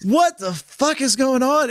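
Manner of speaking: mock astonished outburst; retold shock. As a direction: ask it high and stunned. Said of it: "And you're like".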